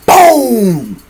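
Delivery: loud punch sound effect shout; big shouted boom. Acted. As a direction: slam the word out like the hit lands. Narrating punch impact.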